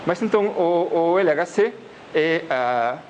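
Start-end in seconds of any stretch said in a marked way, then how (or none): none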